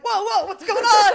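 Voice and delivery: high, loud alarmed cry; startled cells. Pretend. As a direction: jolt in alarm. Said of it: "the cells are like".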